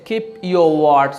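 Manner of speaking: "Keep your word" said very slowly, each word drawn out; slow teaching read of "Keep your word"; slow demo. Shown as a demonstration. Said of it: "that's the moment you can say".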